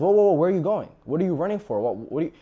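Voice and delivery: loud, high whoa-whoa stopping him; alarmed brother. Retold or imitated. Older brother stopping the servant, loud and demanding. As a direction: grab him and demand answers. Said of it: "grabs the servant and says".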